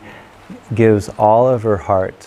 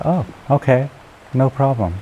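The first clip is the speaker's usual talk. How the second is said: higher-pitched, lightly acted hopefulness; ready agreement; 6 semitones higher than usual. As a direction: agree readily, voicing her renewed hope. Woman readily accepts the condition.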